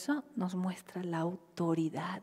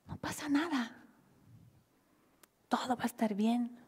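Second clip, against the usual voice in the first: soothing; 4 semitones higher, 3 dB quieter than usual. Retold or imitated. Comforting child.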